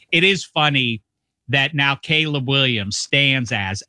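ordinary talk, nothing marked

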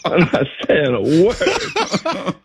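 slow, said through laughter; laughing. Real, not acted. Player remembering his silence, laughing slowly.

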